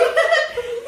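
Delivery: high, loud burst of laughter. Real, not acted. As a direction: crack up laughing. Laughing about him crying.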